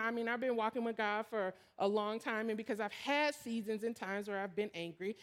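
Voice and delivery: ordinary delivery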